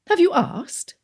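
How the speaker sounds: aunt demanding of nephew; aunt, direct. A narrator's reading. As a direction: put her on the spot. Aunt presses.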